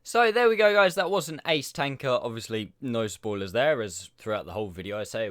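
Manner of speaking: usual speaking voice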